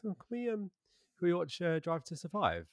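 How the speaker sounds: a hint of a wife asking nicely; mimicking wife. Husband voicing his wife's request.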